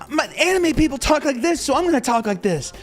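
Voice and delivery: mock a cosplayer talking like a cartoon; exaggerated anime voice, mocking; anime cadence parody. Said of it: "doing the anime cadence".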